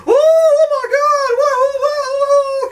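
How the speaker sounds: shrieking high, horrified whoa; family shrieking at the sight of her. Retold or imitated. Family shrieking at their mutated daughter, horrified. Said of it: "and they're like".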